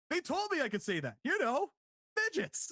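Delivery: high-pitched, emphatic mock insistence; Michael Cole, emphatic. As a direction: imitate an eager commentator punching the last word. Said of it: "don't say it so emphatically".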